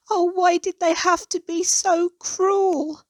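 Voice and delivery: high, slow, tearful cry of hurt; sobbing. A narrator's reading. Little goose weeping alone at her pond, sobbing. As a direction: sob out the question, voice lifted high. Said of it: "she sobbed".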